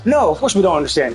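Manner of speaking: normal talking voice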